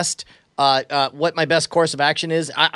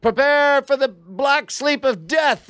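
higher, slow, grand villain threat; dramatic threat; 10 semitones higher, slower than usual. Acted. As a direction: declaim it like a hammy warrior. Player's dramatic battle threat.

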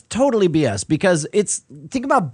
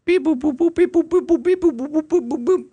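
robot beeps; 9 semitones higher than usual. Acted. Robot scanning DNA.

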